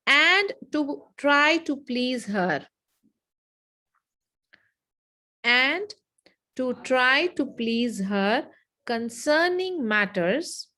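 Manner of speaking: drawn-out dictation with long pauses; slow repeat. Shown as a demonstration. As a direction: stretch each phrase for note-takers.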